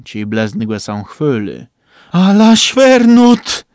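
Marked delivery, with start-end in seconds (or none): 2.1-3.6 slow, loud, high cry of alarm; drawn-out frightened shout. A narrator's reading. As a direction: bellow it out in shock. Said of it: "krzyknął trumba".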